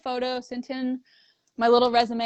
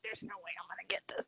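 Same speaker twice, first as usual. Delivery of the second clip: whisper; 5 semitones lower, 18 dB quieter, faster than usual. Retold or imitated. Doubting herself before applying.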